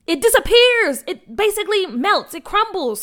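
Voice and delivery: high, loud, bursting with the idea; excited exclaiming. Acted. McKinley revealing her water-gun plan to the crew, excitedly. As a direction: sell the discovery with a rush of excitement.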